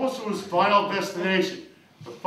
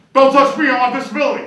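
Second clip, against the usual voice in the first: alarmed yell; 5 semitones higher, 7 dB louder, faster than usual. Acted. Man yelling to keep benefits.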